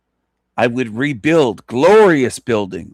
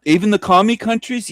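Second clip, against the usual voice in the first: high, disbelieving question, lightly voiced; a hint of a doubting listener asking; mock incredulous question; 9 semitones higher than usual. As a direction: play the shocked listener asking it. Answers an imagined incredulous question.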